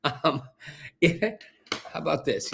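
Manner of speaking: soft, amused talk broken by laughs; trying to talk through a quiet laugh. Real, not acted. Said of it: "Why do you start laughing?".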